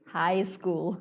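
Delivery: "high school" as "hai skul"; correct pronunciation. Shown as a demonstration. Teacher putting the word together, correct pronunciation. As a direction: keep "high" open, nothing before "school". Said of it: "cara pengucapannya adalah high".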